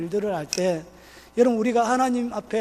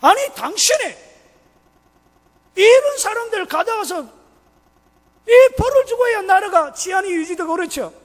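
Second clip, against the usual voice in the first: loud, high, heated protest; angry policeman; 10 semitones higher, 8 dB louder than usual. Acted. Angry policeman arguing with the forgiving victim, loud indignant protest. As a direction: scold in raised, exasperated outbursts. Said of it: "경찰이 화가 난 거야".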